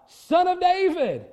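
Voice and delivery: high, loud cry for help. Acted. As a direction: call out at the top of your voice. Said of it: "No, you, you cry out".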